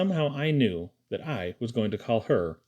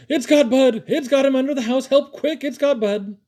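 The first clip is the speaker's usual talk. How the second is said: screaming alarm, much higher and louder; Caleb; 12 semitones higher, 6 dB louder than usual. A narrator's reading. Caleb bursting into the kitchen for help, screaming. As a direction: yell frantically for help in a high voice. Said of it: "He burst into the kitchen screaming".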